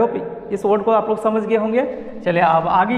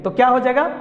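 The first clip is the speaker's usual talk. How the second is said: ordinary talk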